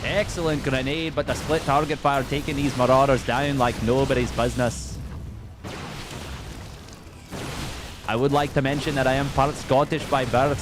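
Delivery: put-on Scottish accent, lively play-by-play. Caster calling Clem's match for fun, Scottish accent. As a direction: cast the game in a Scottish accent. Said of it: "since I've done a Scottish accent".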